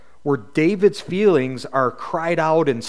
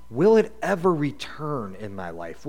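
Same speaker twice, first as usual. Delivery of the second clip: normal talking voice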